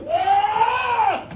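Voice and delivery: sudden loud pained cry out; a sharp shout of pain. Acted. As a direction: cry out as he collapses. Boy collapsing in sickness.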